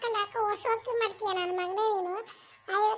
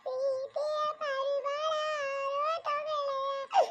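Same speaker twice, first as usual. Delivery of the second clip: very slow, softer, sobbing lament; mock crying song; 4 semitones higher, 4 dB quieter, much slower than usual. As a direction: let the tune break into a comic sob. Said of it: "ನೀನ್ ಯಾಕೆ ಹಿಂಗ್ ಅಳ್ತಾ ಕೂತಿದ್ಯಾ?".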